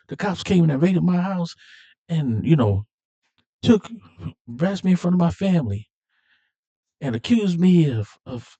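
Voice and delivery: high-pitched retold outrage, building to the charge; acted indignant accusation. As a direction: play an aggrieved man airing his grievances. Acting out Johnny Tran's angry accusation.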